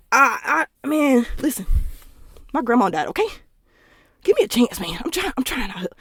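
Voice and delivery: hammed-up sob-story plea; mock pleading guy. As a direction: beg for a chance, overplayed. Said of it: "they be like".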